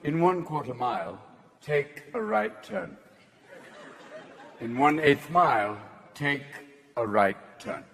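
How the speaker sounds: clipped haughty British lady directions; bitchy English voice. Retold or imitated. The English GPS giving turn directions, prim English accent. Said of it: "That's a bitchy English voice".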